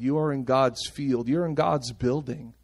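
usual speaking voice

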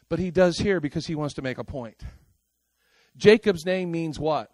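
ordinary talk, nothing marked

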